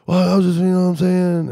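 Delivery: a caught guy fumbling for words; flustered excuse. Retold or imitated.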